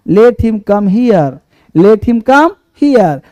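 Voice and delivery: very slow, word by word; slow dictation. Shown as a demonstration. Teacher dictating the finished sentence to students, very slowly. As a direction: spell the whole line out slowly. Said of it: "और यहाँ का other word".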